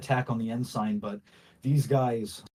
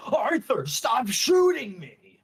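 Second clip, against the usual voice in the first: high, slow pained yell; cry out in pain; 13 semitones higher, 6 dB louder, slower than usual. Acted. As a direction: yell it out, hit and hurt. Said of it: "he cries out, he goes".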